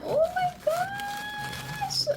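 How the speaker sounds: long high squeal of pure delight; drawn-out squeal. Real, not acted. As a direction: stretch it out in a thrilled high cry. Still marvelling at the shoes.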